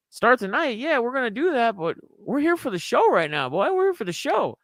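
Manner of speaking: high-pitched imitation of an insistent buddy; mock-indignant friend. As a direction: put on a high, protesting voice to mimic him.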